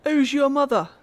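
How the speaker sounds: mock child voice. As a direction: ask it like an eager little boy. Mock-voicing the boy character.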